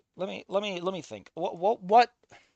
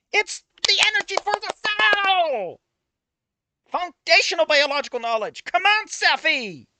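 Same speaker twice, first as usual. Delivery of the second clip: much higher, loud, slow exasperated yelling; frustrated rant; 13 semitones higher, 8 dB louder, slower than usual. Real, not acted. Streamer scolding himself over cell biology, loud exasperated rant. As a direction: shout it out, fed up with yourself. Ranting at own mistake.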